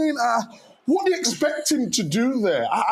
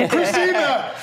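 laughing; 4 semitones higher than usual. Real, not acted. Laughing along with a guest.

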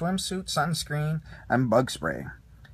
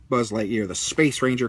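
ordinary delivery

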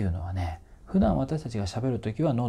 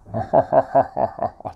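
loud, booming demonstrated laugh in separate bursts; resonant laugh; 3 semitones higher, 7 dB louder than usual. Shown as a demonstration. Coach shows English-style resonance inside, loud ringing mock laugh. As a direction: let each "ha" resound inside the mouth. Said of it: "この中で響く感じ".

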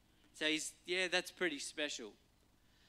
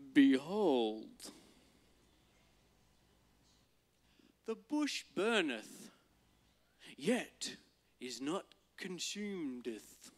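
put-on slow, grand old-film Moses proclamation; Charlton Heston; 4 dB louder, slower than usual. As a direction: boom it out like an epic movie prophet. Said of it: "You see the Charlton Heston come out".

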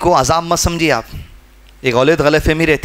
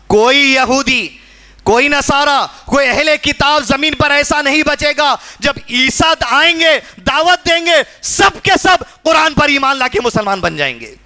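raised, proclaiming sermon voice; impassioned preaching; 8 semitones higher than usual. Real, not acted. Preacher foretelling the coming of Isa, impassioned proclamation. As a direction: proclaim it high, dropping at the close.

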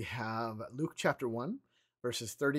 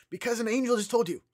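higher and louder, a quick incredulous comeback; exasperated retort; 9 semitones higher, 6 dB louder, faster than usual. Pretend. Preacher answering doubting Zechariah with an exasperated retort. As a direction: toss it back as if it were obvious.

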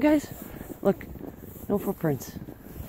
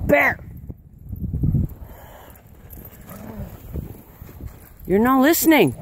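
loud, high call to the dog; sharp yell at a disobedient dog; scolding; 5 semitones higher, 4 dB louder than usual. Real, not acted.

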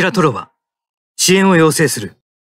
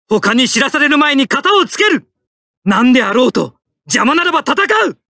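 high, forceful determined shouting; fierce combat lines; 11 semitones higher than usual. Acted. Pilot charging to finish the enemy, high determined shouts. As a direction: drive it home like a charging attack.

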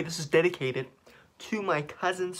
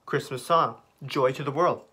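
normal talking voice

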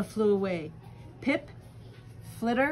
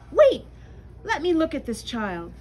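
excited; 6 semitones higher, 6 dB louder than usual. Acted. Mother bat pushes through.

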